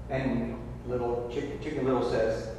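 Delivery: normal talking voice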